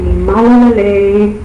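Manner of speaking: "Malalale" said slowly as a Tongan greeting, every vowel full; Tongan dialect. Shown as a demonstration. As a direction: pronounce "Malalale" the Tongan way, drawn out. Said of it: "give us a sample of your Tongan dialect".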